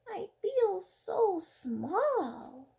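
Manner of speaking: tiny snail voice, high and very slow; small snail voice. Acted. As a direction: let the little snail sound awed and small. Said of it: "and said to the whale".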